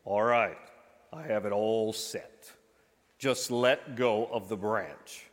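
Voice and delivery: God calmly tells the man to let go, low and slow. Acted. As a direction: speak slowly and calmly as God. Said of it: "hears the voice of God again say".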